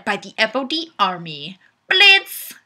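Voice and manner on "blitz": high, loud single shout; shouted blitz. Pretend. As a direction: yell it like a battle cry. Shouting the comment's punchline.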